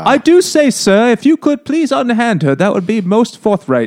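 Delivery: hammy stage-posh English butler. Retold or imitated. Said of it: "This is like the butler in Richie Rich".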